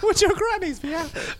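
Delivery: high laughing squeal. Real, not acted. Laughing shout.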